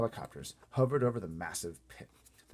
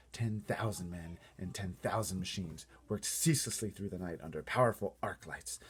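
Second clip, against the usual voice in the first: plain reading voice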